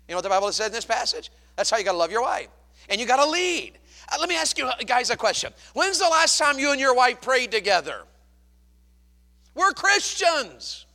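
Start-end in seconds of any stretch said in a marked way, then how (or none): 2.9-10.8 loud and high, stretching the key words; full-force preaching. Real, not acted. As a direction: belt it out to the congregation. Preacher challenging husbands.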